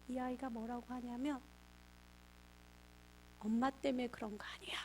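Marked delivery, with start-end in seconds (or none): 3.4-4.8 hushed, tearful whisper; whispered confession. Retold or imitated. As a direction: breathe it out near tears. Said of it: "그때 펑펑 울기 시작하더래요". (울음을 참으며 속삭이듯)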